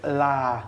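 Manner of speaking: La with the A drawn out long. Shown as a demonstration. Tutor showing the slang word, long A. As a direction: stretch the A in La. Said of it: "You really elongate the A".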